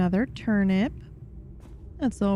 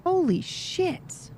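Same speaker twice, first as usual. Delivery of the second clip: slow, high, drawn out disbelief; stunned awe; 10 semitones higher, slower than usual. Real, not acted. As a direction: stretch it out in awe. Stunned by the loot.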